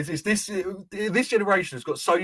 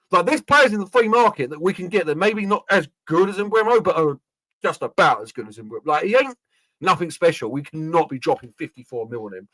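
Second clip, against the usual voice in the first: normal talking voice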